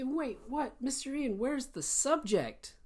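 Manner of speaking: high, puzzled student voice; confused student. Retold or imitated. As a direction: play a baffled learner asking the teacher. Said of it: "You say".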